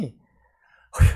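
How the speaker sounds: long drawn-out loud sigh; child's sigh. Retold or imitated. Pastor imitates the child's heavy sigh: loud slow sigh. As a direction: heave a slow, heavy sigh like a troubled boy. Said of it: "하면서 한숨 쉬면서".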